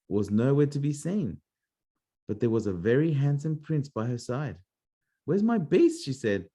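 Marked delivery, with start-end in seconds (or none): 5.3-6.0 Beauty's anxious question. Acted. Beauty looking for Beast.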